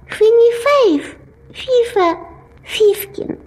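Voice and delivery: slow lisping mush; slowly, through a fat tongue; slow swollen tongue. Acted. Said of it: "Это я сказал медленно".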